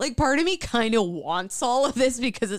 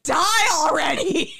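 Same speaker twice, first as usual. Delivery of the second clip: shouting; 6 semitones higher, 4 dB louder than usual. Retold or imitated. Voicing family at a hanging.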